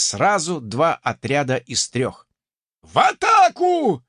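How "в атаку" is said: high, loud, slow drawn-out yell; the NPC keeps hollering at his men; ranting battle cry. Acted. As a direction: bellow the charge over and over. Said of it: "продолжал разоряться непись".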